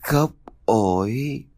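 slow, faint ghost voice calling from afar; drawn-out echoing call of a dead old woman; a faint ghost's wail; distant ghostly echo. A narrator's reading. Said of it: "tiếng vọng lại của bà không rõ ràng".

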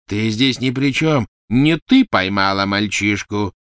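slow, loud, heavy dismissal; angry rebuttal. Acted. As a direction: push the rival firmly out of it. Quarrel over who caught the boy. (медленно, сердито и веско)

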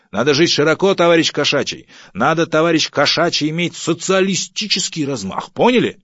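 high, loud pompous lecturing; bossy harangue. Acted. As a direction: lay down the law to a subordinate. Boss lectures thrifty subordinate. (громкая начальственная нотация)

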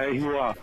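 R O A spelled letter by letter; spelling out the name. Shown as a demonstration. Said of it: "Não é rua".

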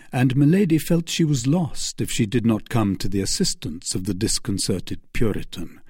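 ordinary delivery

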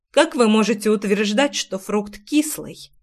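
plain reading voice

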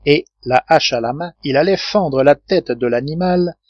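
usual speaking voice

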